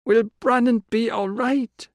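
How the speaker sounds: put-on high, slow child's voice; a small boy asking fearfully; worried boy. A narrator's reading. Inam asking Tilla about his brother, high child's voice.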